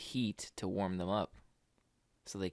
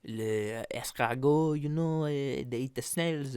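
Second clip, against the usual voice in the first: put-on French accent; 3 dB louder than usual. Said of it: "Your French accent is just every word ending in, uh".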